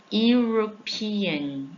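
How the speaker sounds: European drawn out slowly with a yu onset; slow yu sound. Shown as a demonstration. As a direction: stretch the yu in European. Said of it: "tem som de I U".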